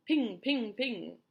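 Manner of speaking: loud ringing pings; ricochet pings. A narrator's reading. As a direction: ring each word out. Imitating hailstones bouncing off shell.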